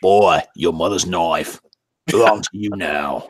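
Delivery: put-on Australian-accented warrior father; stern game hero gone Australian; Kratos. Game father to his boy, broad Australian accent. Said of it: "if Kratos was Australian".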